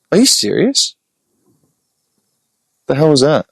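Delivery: incredulous. Real, not acted. Reacting in disbelief.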